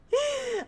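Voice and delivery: high giggle; short high-pitched laugh. Real, not acted. Laughs after own remark.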